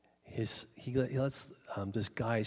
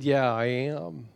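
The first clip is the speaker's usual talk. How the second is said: slow, loud, stubborn admission; retold anger; 6 dB louder, slower than usual. Husband admits to pastor he is still mad, imitated anger. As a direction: play a mad man owning up, drawn out and firm. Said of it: "red-faced mad".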